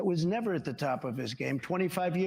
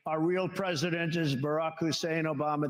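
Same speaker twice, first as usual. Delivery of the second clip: ordinary talk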